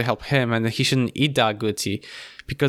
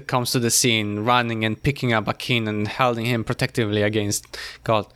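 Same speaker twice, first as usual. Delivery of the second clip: ordinary delivery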